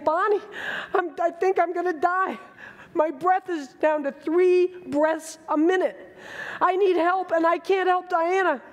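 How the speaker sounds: a hint of the stung man's fear; retold frightened plea. Stung crewman calling to the trainer, a lightly acted frightened plea. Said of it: "he's yelling to my trainer".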